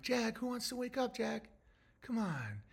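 put-on softly coaxing a sleeping kid awake; gentle, soft wake-up call. Parent gently waking a sleeping child, soft coaxing. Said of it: "And you're like".

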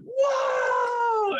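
long high-pitched "whoa"; drawn-out mock cheer, held high; imitated crowd cheer. Family going crazy at the win, high sustained cheer. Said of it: "they all just went".

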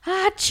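mock sneeze. Imitating Jeremy's sneeze.

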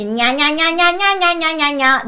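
high, loud neighbour-like nia-nia vocalise; screechy nasal nia-nia. Shown as a demonstration. Coach models the exercise, screechy nia-nia. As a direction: taunt out a screechy run of nia. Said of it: "să fie cât mai țipător".

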